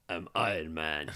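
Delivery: low, slow solemn hero line; Iron Man line. Retold or imitated. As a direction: deliver it slow and low, like a final stand.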